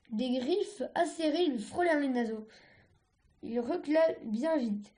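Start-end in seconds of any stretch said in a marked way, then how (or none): none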